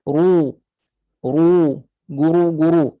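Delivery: RU and GURU with heavy, dark RU; thick ru. Shown as a demonstration. Teacher models for students, thick ru. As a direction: raise the tongue back on RU, guru. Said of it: "bunyi ru yang tebal".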